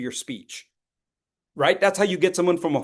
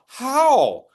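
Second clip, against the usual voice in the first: baffled student; 10 semitones higher, 5 dB louder than usual. Retold or imitated. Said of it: "they'll look at me and go".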